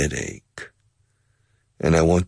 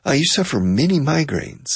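listener voice; 8 semitones higher than usual. Acted. Voicing a listener.

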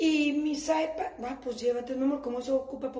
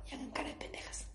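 whisper; 13 dB quieter, faster than usual. Real, not acted. Whispered advice to audience.

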